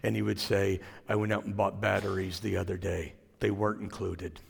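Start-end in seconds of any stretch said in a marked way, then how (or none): 1.1-4.4 low, soft, deadpan monotone one-liner; Stephen Wright monotone. Retold or imitated. Preacher imitating a comedian's droning monotone joke. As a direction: drop into a flat, lifeless comic drone. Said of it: "he just would talk like this all the time".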